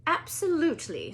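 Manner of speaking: high, crisp and certain; stern Scottish teacher, crisp and sure; crisp McGonagall. Acted. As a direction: snap out the answer. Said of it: "said Professor McGonagall crisply".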